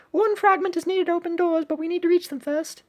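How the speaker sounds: squeaky eager cartoon sidekick reading game dialogue; high cute shark voice. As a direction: pitch way up for the little shark character. Reads shark character's lines.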